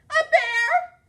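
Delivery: high, loud panicked shriek; shrieking fright. Acted. Librarian shrieks at the dog she thinks is a bear, terrified. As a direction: shriek it out in terror. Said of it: "she said".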